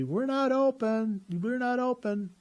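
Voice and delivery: very high, drawn-out mock yell; imitated yell. Volunteer calling to early visitor through glass doors, imitated yelling. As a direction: call it out slowly in a high imitated voice. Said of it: "she yells at me".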